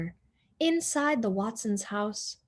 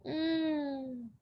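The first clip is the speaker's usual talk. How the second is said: yummy hum; 6 semitones higher, 6 dB quieter than usual. A narrator's reading. Savoring toast.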